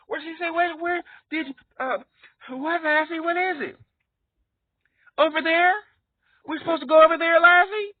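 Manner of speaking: put-on high, loud kid voice questioning the dog; TV kid talking to dog.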